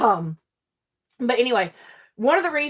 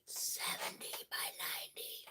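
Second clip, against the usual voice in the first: awed whisper; 23 dB quieter than usual. Shown as a demonstration. Telling viewers the canvas size, awed whisper. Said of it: "This thing is a seventy by ninety".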